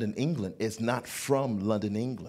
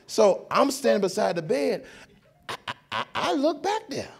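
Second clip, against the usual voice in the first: ordinary talk, nothing marked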